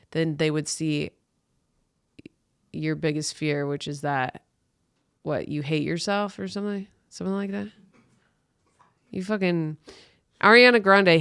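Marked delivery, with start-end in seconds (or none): none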